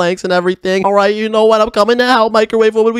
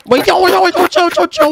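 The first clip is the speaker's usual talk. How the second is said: alarmed yelling; 9 semitones higher than usual. Acted. Stepsister threatens to poop inside.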